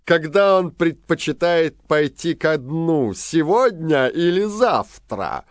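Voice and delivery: loud, scornful royal command; mocking king. A narrator's reading. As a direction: bellow it like a sneering king. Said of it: "он расхохотался и велел".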